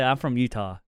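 "Utah" with the T swallowed; dropped T in Utah. Shown as a demonstration. Local showing the Utah tang, "Utah" without a crisp T. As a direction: say "Utah" softening away the T. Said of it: "you don't say the T in Utah".

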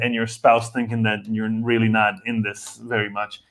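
ordinary talk, nothing marked

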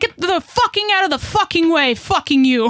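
high-pitched mock tirade in broken English; imitating mom's broken English. Daughter imitating mom's road tirade, high broken English. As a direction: rant like a mom with broken English. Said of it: "She's like".

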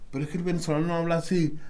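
quick, high, lightly voiced disbelief; doubting foreigner. Foreigner doubts he's Venezuelan, fast and high-pitched. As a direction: rattle it off as a skeptical outsider.

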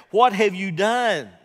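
loud, high, slow, demanding; accusing shout. Acted. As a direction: hurl the question at him. Sailors confront Jonah, shouted.

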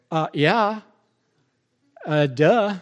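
retold reply, as if it were obvious; mock duh. Preacher retelling his reply to God, mock duh.

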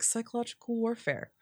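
ordinary delivery

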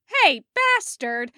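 high, loud and slow outcry; startled yell. Acted. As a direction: shout it in sudden surprise. Startled yell before collapsing.

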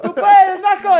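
high, loud encouraging shout; nephew cheering. Retold or imitated. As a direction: yell it down like the nephew up ahead. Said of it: "Y mi sobrino de arriba". (gritando ánimos como el sobrino)

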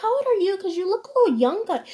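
high, sweet mock voice of a curious stranger; imitating the stranger. Stranger questions her age.

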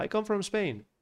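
light, high voice in English; high-pitched playful reply. Retold or imitated. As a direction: voice your younger self, upbeat and high. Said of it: "Y yo".

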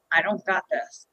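low, quiet, defeated mutter of dread; scared reply. Retold or imitated. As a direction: shrink into the fear and deny it. Scared reply to husband on race morning.

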